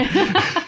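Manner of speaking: high laugh. Real, not acted. Laughing at own blunder.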